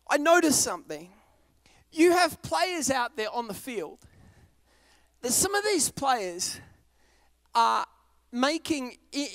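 ordinary delivery

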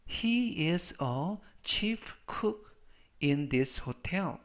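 very slow, word by word; slow model reading. Shown as a demonstration. Teacher models the hotel chef sentence for learners, slow model reading. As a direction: say each word slowly for learners to repeat.

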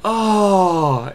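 sudden loud high yelp of pain; a mock yelp as he trips; hammed-up cry. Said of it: "He goes".

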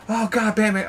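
real startled shout; surprised yell. Real, not acted. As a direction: blurt it as the car crashes. Crash in game.

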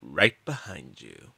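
low, soft, slow reveal; sly unseen character. Acted. Unseen Fast Track to searcher, quietly ominous.

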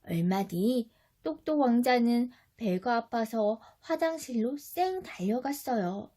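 ordinary delivery, nothing marked

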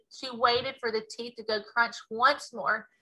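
ordinary delivery

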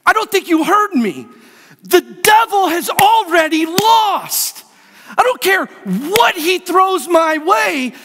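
high, shouted victory proclamation; full-force preaching. Real, not acted.

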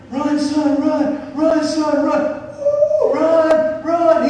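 put-on high, loud stadium yelling at his boy; cheering scream. Dad in the stands screaming for his son, full-throated cheer. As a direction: yell him round the bases with all you've got. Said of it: "We are screaming".

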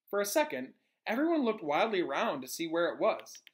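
usual speaking voice

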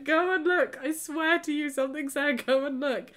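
high, loud, insistent urging; urging her boyfriend outside, loud and desperate; desperate pleading. Retold or imitated. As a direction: push him to go check, near tears. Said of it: "I'd broken down crying".

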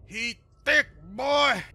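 high, loud hammed-up hype voice; mock meme voice. Streamer to chat about a creature, hammed-up meme voice. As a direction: squeal it like a meme.

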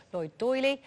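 ordinary talk, nothing marked

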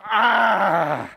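loud cathartic yell for the congregation; releasing scream. Pretend. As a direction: let some of it out with one loud yell. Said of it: "maybe we need to all stand up this morning and just go".

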